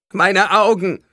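high, anguished scream. A narrator's reading. A blinded victim cries out about their eyes, screaming. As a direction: scream out in horror. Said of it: "schrie jemand".

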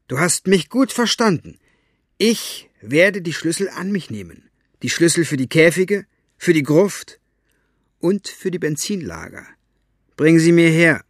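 high, loud commanding villainess; demanding, icy and superior; mustache twirling villain. Acted. Mortola demands the keys. (schurkisch befehlende alte Frau)

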